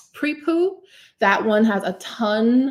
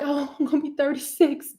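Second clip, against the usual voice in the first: mock dread; 6 semitones higher than usual. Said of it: "I'm not even like".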